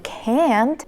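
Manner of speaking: "can't" with breath out and a silent final t; stressed "can't". Shown as a demonstration. Teacher demonstrates the negative, emphasized "can't". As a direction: lean on "can't", leave off the t. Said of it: "a little bit of breathing out".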